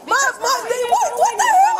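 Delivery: high, loud, real alarmed outburst; startled protest. Real, not acted. Woman being grabbed by police, startled loud protest. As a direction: cry out in sudden protest.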